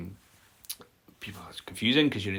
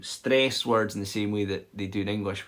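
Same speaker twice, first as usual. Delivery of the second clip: ordinary talk, nothing marked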